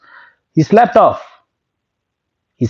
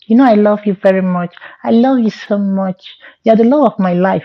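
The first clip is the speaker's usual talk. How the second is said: lightly voiced lovesick girl, a little higher; girly voice; 5 semitones higher than usual. Possessed friend coos to him in a light girly voice. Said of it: "he started talking like a girl".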